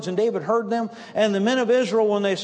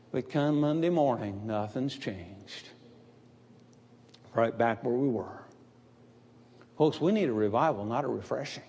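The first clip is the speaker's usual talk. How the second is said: much lower and softer, dropped to a hush; hushed preaching; 9 semitones lower, 7 dB quieter than usual. Acted. Preacher lowering voice to stress the letdown, hushed. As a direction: pull the congregation in with a hush. Dramatic quiet contrast in sermon.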